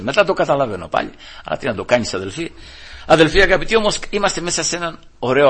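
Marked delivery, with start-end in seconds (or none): none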